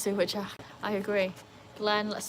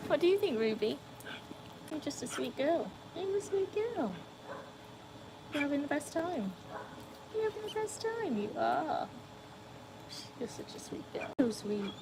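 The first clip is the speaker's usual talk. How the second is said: much higher, soft cooing to the dog; dog baby talk; 7 semitones higher, 7 dB quieter than usual. Acted.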